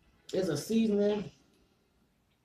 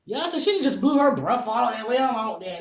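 exclaiming; 6 semitones higher, 9 dB louder than usual. Real, not acted. Reacting loudly to someone blowing on food.

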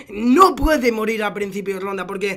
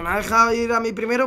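normal talking voice

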